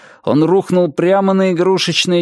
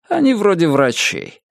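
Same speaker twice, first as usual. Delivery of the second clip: plain reading voice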